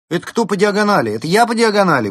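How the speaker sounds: high, loud outraged retort; indignant. A narrator's reading. Fox accused of skimming by the penguin, indignant. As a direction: fire back in indignation. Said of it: "возмутилась Берта".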